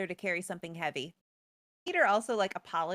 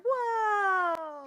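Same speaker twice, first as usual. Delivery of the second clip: high, louder astonished cry; amazed higher exclamation; surprised; 9 semitones higher, 4 dB louder than usual. Real, not acted. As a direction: gasp out the twist, way up high. Reveal of Peter, surprised cry.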